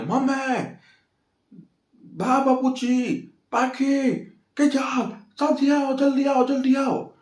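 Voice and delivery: high, frantic yelling for the family; panicked shout. Retold or imitated. Toshu caught stealing, yells for the family in panic. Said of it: "Toshu ने चिल्ला दिया".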